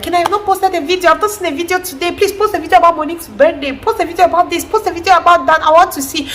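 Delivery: high, pleading mom voice; eager mom. Retold or imitated. Vlogger's mom asking for a new video, high and eager. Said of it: "my mom calls and she's like".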